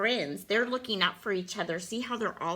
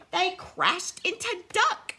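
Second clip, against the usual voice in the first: higher, punchy storybook excitement; dramatic reveal; 7 semitones higher, 4 dB louder than usual. A narrator's reading. Reader tells child of the crash, dramatic excitement. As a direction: build up to the last word.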